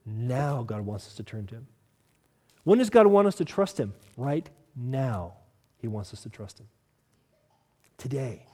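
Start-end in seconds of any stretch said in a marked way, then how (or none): 0.0-1.7 much softer and lower, intimate; hushed answer. Acted. Quiet answer to own question. 4.2-8.5 very soft and low, drawn in; hushed answer. Acted. Quiet answer to own question.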